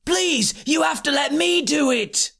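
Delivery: high-pitched desperate plea; pleading yell. A narrator's reading. Joshua begs to take on the challenge, yelling. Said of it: "Joshua yelled".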